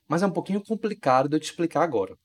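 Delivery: ordinary delivery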